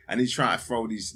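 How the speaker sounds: ordinary talk, nothing marked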